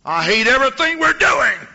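high, loud cry of hate; angry outburst. Retold or imitated. Colleen renounces cult, angry.

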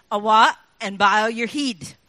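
broad Scots saying, rolled and clipped; Scottish accent. Shown as a demonstration. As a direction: say it like a Glaswegian telling you off. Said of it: "another saying that they say is".

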